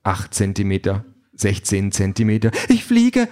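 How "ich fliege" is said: high, louder, thrilled cry; excited call. A narrator's reading. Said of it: "ruft sie aufgeregt".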